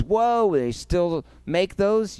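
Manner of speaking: high whoa, then a slow joking question; mock surprise. Host teases his own DVD sales with mock surprise. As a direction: pretend to be amazed anyone still sells them.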